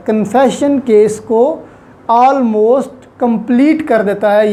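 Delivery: ordinary talk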